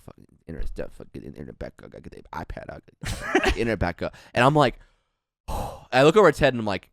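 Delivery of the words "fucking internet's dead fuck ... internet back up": much softer, lower, under the breath; muttering to himself. Retold or imitated. Strange owner muttering to himself over the tablet. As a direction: mutter it to yourself, not to them. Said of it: "he starts talking to himself".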